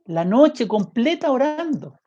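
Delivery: high, pleading preacher's lament; impassioned preaching. Acted. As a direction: cry out the shame to the congregation. Fervent shame over Jesus praying.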